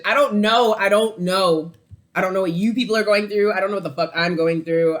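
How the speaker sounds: loud, high, exasperated cry; letting it all burst out loud; overwhelmed outburst. Real, not acted.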